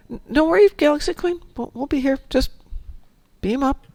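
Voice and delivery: high playful quoting voice; light imitation of her own chat; mock game chatter. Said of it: "To which, of course".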